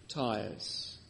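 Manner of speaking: normal talking voice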